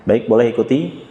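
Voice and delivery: ordinary talk, nothing marked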